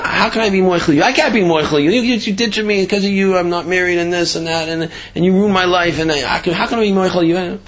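retold exasperated outburst; indignant refusal. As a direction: act out a hurt man refusing to forgive. Wronged man retold refusing forgiveness.

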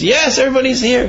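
family losing patience with the dying miser; impatient. Retold or imitated. Family answers the dying miser, exasperated.

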